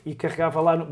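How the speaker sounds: ordinary talk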